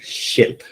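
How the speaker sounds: Ship whispered with a short clipped i. Shown as a demonstration. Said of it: "Yes, very good".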